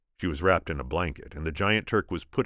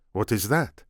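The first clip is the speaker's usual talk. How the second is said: put-on Spanish accent; 6 semitones higher, 5 dB louder than usual. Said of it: "my attempt at Inigo".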